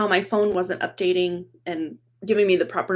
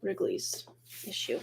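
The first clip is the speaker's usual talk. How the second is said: whisper; 2 semitones lower, 14 dB quieter than usual. Real, not acted. Muttered aside to herself.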